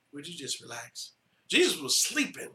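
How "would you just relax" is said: soft calming. Acted. Jesus calms them, soft and low.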